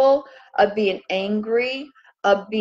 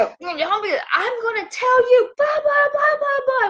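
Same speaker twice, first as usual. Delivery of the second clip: high shrill mock yelling; hammed-up row, voice way up; mock shouting fight; 14 semitones higher than usual. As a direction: yell it like a heated argument. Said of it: "We used to raise our voice at each other".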